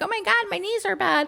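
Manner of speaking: high, soft mock complaint; struggling mom imitation. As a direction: imitate a mom lagging behind on bad knees. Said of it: "She's like".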